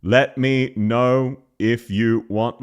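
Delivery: "Let me know if you want" slow on one note; unchanging tone. Shown as a demonstration. Showing her voice doesn't change, slow monotone. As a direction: spell out each word of "Let me know" monotone. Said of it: "my voice doesn't change".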